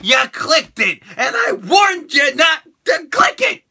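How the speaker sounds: building from teasing to a shout. Acted. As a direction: start playful, end yelling. Teasing viewer, rising shout.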